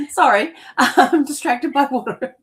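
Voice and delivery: high, loud, laughing through the words; real laughing apology. Real, not acted. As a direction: apologise while cracking up at your own spill. Laughs after squirting water on desk.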